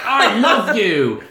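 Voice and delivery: loud, high, drawn-out gushing; mock love declaration. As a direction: blurt out a hammed-up profession of love.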